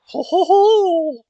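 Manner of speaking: very high, loud, slow hoot; hammed-up jolly exclamation; mock delighted ho ho ho. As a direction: whoop it out in mock glee.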